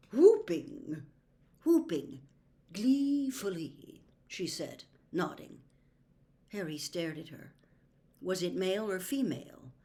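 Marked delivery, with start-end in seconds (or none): 0.1-4.0 mystical seer voice. Acted. Trelawney voice.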